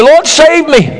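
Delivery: cried out high, pleading; high desperate plea to the Lord; urgent cry. Shown as a demonstration. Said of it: "Send out that prayer".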